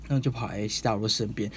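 usual speaking voice

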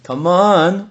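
drawn-out incredulous "come on"; exasperated come on. Acted. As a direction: stretch it out in playful disbelief. Teasing disbelief at late rules.

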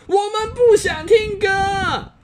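very high, slow group shout; everyone roaring. Acted. The whole family roaring at Dongdong. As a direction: yell it all together, fed up. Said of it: "全都跳起来吼".

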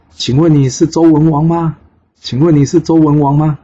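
high, loud call from the sky; loud shout. Acted. Son calling to King Wen from the air, shouting. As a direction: shout the question down to someone far away. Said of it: "他在空中大喊说".

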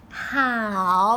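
small, low, drawn-out answer; tearful little assent. A narrator's reading. Princess wiping her tears agrees to the party, meekly. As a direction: answer meekly, as if just done crying. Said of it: "擦擦眼泪说".

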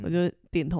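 usual speaking voice

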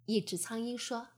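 usual speaking voice

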